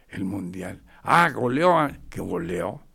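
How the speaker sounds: ordinary talk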